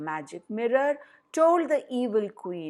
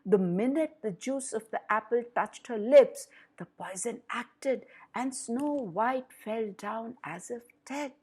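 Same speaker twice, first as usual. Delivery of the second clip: plain reading voice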